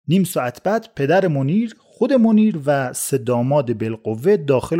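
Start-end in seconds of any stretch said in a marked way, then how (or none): none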